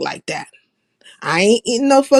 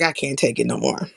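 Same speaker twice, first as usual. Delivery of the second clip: low, soft, inward sigh of a thought; inner voice; 8 semitones lower, 6 dB quieter than usual. Retold or imitated. Fed up with a friend's talk, thinking it quietly. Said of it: "In my head".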